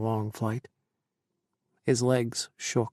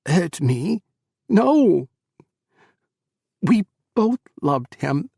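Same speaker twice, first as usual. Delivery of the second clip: higher, louder, grieving cry; anguished outburst; 7 semitones higher, 10 dB louder, slower than usual. Acted. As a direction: hurl it through tears.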